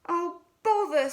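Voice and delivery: slow, high fretful bear; stuck Pooh. A narrator's reading. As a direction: groan it out while wedged tight.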